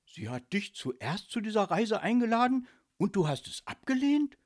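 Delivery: loud, high incredulous question. A narrator's reading. Micky learning the ghost turned her down, incredulous. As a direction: ask in disbelief, voice climbing. Friend astonished at the refusal.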